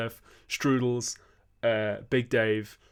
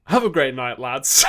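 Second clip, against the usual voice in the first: loud sign-off; 3 semitones higher, 10 dB louder than usual. Real, not acted. Signing off the stream.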